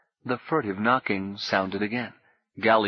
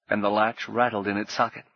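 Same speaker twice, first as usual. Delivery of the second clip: usual speaking voice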